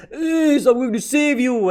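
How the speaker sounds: high earnest heroic cry; heroic giant. Retold or imitated. Imitating Oars reaching Ace, determined. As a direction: call out to him like a loyal giant. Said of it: "he's like".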